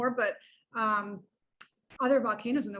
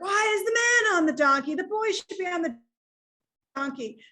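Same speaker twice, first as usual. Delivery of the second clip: mocking onlookers; 7 semitones higher than usual. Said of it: "everyone's like".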